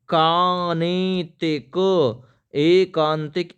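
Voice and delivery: each sound drawn out very slowly; slow, careful dictation voice; slow spelling demo. Shown as a demonstration. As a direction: stretch एकांतिक out slowly so students catch every letter. Teacher spells out a word.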